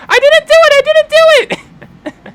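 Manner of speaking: very high, loud panicked yell; Rune yelling denial. Acted. Rune yells from inside the house as the door is stormed, shrill protest. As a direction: squeal the denial at full volume. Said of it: "Rune's yelling".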